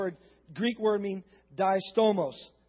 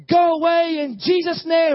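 high, loud imitated child's command; commanding shout; 7 semitones higher, 8 dB louder than usual. Preacher voices son banishing bear, shouting.

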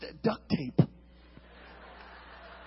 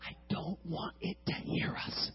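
whispered, high and slow; 12 dB quieter than usual. Retold or imitated. Boy explaining to his friend, scared whisper. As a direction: breathe it so the firework won't hear. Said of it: "Why are we whispering?".

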